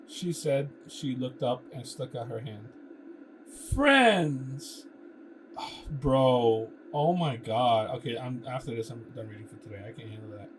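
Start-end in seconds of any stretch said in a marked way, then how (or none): 3.7-4.8 a loud, high "Friends!"; bright loud offer. Acted. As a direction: hold out your hand and declare it. Kara offers a handshake.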